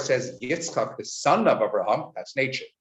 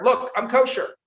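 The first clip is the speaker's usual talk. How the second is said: much higher proud little declaration; pig declaring; 12 semitones higher than usual. Acted. Pig boasting to onlookers, high and proud. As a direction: show off like a pig flaunting its hooves. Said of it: "as though to declare".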